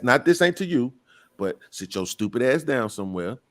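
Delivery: ordinary talk, nothing marked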